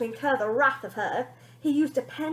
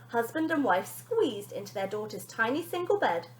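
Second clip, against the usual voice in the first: plain reading voice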